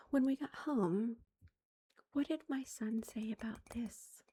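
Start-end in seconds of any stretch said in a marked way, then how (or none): none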